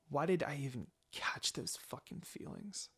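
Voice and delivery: soft, bitter whisper to herself; whispered inner thought. Acted. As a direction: hiss the regret under your breath.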